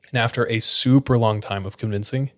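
ordinary delivery

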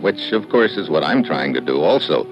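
low, dry detective narration; story narration. A narrator's reading. Detective narrating his case to listeners, low and dry. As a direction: tell it like a weary private eye.

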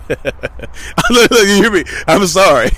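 real laughter bubbling through the words; laughing. Real, not acted. Dad excusing his late podcast to listeners, laughing.

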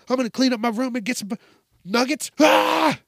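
high, rushed, ending in a shriek; frantic eager kid. Acted. Kid promising chores for nuggets, frantic excitement. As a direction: gabble it high like a food-crazed kid.

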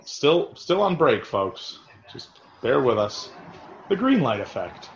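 ordinary talk

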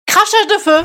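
very high, forceful exclamation; annonce criée. Acted. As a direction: shout it out like a circus act. Clame son numéro de cirque. (cri aigu de bateleur)

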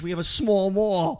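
small mall rhymed with broad aw; laughing small mall; Jersey vowel in small mall; Jersey accent. Retold or imitated. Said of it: "to this day, they go".

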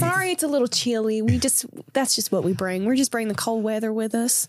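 apologetic Southern host; folksy, put-on Southern accent; Southern Dementor. Dementor excusing the cold they bring, folksy Southern accent. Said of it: "I love the Southern Dementor bit".

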